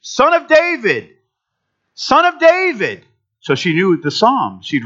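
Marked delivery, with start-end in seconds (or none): 0.0-1.1 high, drawn-out cry; imitating a woman's pleading yell. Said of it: "she started to yell". 1.9-3.0 high, drawn-out cry; imitating a woman's pleading yell. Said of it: "she started to yell".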